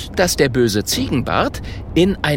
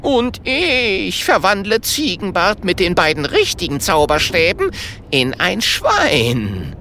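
gleeful relish; 7 semitones higher than usual. A narrator's reading. Wizard imagining his enemy as a pig, gleeful. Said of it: "Holunders Augen leuchten".

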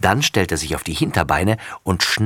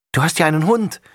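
higher, admiring exclamation; admiring call; 9 semitones higher, 3 dB louder, faster than usual. A narrator's reading. Sophie admires Laura's dog, calling out high. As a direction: gush over the dog. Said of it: "ruft Sophie bewundernd".

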